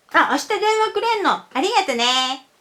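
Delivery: high, fast and casual Japanese, lightly acted; acted Japanese reply. Japanese speaker thanks someone for calling, in a high, fast voice. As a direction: dash it off like a pleased Japanese friend.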